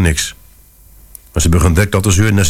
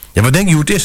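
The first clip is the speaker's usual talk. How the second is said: furious; 8 semitones higher, much faster than usual. Acted. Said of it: "Mevrouw was razend".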